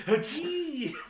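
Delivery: high hammed-up howdy; mock cowboy howdy. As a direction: stretch out a silly cowboy howdy. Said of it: "say howdy".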